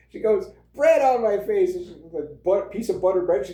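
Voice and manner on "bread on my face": put-on little girl voice. [name]'s punchline at the table.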